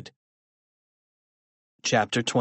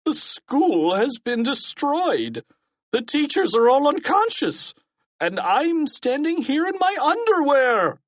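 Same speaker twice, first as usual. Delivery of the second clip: high-pitched, outraged principal; distraught cry; 4 dB louder than usual. A narrator's reading. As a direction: wail each disaster, pitched way up. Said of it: "cried Mister Krupp".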